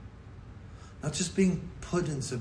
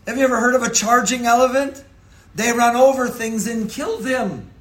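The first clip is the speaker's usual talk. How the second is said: loud, rising sermon build, higher and louder; preaching at full force; 10 semitones higher, 11 dB louder than usual. Acted. Pastor to congregation, building loud preaching. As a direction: preach it out to the back pews.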